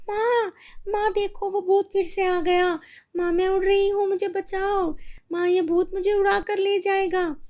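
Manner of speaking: put-on high, loud terrified child; girl floating away, screaming for her mother; panicked girl. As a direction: cry for help. Girl flying again, panics.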